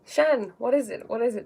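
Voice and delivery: normal talking voice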